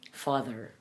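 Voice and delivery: father with a buzzed "th"; softly said father, voiced th. Shown as a demonstration. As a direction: vibrate the cords on the th of father. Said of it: "T H voiced consonant".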